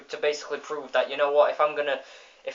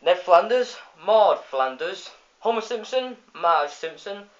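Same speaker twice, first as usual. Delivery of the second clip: announcing shout; 6 semitones higher than usual. Retold or imitated. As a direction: list names like a truck loudspeaker. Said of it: "he shouts out".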